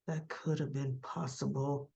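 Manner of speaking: low, hushed retold musing; pondering. Villain considers that his brother faked codes, musing softly. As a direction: imitate him thinking it over, soft and low.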